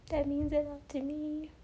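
moved, voice pitched high; high, choked-up and deeply touched; holding back tears. Real, not acted. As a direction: fight tears as you say thanks. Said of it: "gonna try not to cry".

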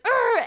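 high, loud mock groan of frustration; hammed-up huff of annoyance; angry groan. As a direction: let out an exaggerated exasperated ugh. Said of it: "marching around and she's like".

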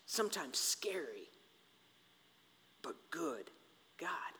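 hushed, scary whisper; breathy hush for the scary part. Shown as a demonstration. Describing God as scary.

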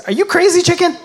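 high, loud squeal of a pig who won't be bacon; high incredulous pig voice; indignant pig. Acted. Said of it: "And the pig says".

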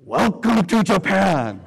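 slow, careful mock Japanese businessman; businessman greeting. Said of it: "He just stops me and he says".

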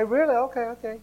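high, disbelieving then giving in; surprised kid. Retold or imitated. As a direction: go high on Really, then shrug it off. Child giving in.

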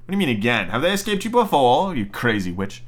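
plain reading voice

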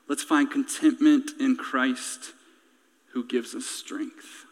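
low, hushed preaching; sermon's quiet close. Real, not acted.

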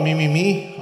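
ordinary talk

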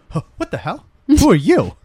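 mock indignation jumping high and loud; pissed off. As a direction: imitate a woman snapping at a stranger, building to a shout. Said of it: "She's all pissed at him".

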